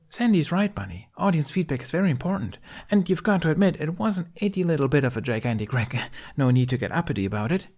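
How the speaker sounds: high, fast, chipper woman; frantic upbeat. A narrator's reading. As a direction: rush the words out excitedly. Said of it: "upbeat way of speaking that was borderline frantic".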